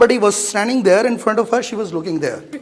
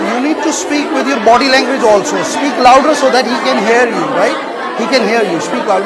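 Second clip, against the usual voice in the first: raised voice, pushing a student to project; coaching call; 3 semitones higher, much faster than usual. Real, not acted. Teacher urging a speaker on stage, raised coaching voice. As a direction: call across the room so she hears you.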